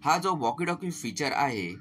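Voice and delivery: usual speaking voice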